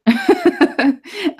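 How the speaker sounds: high-pitched burst of laughter; real laugh. Real, not acted. Laughs after the mock indignation.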